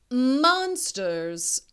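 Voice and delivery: spooky voice. Acted. Miss Wicks imagining monsters.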